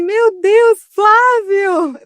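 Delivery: high-pitched delighted gasp of a name; retold joyful surprise. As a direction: burst out as if spotting an old friend. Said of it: "Eu falei assim".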